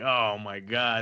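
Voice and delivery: slow drawn-out oh my God; slow dismay. Retold or imitated. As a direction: sigh it out in slow embarrassment. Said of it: "And I'm like".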